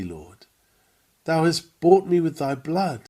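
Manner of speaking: ordinary talk, nothing marked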